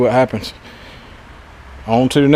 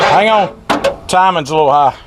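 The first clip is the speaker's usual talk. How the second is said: calling out; 6 semitones higher, 4 dB louder than usual. Real, not acted. Calling to helper over engine.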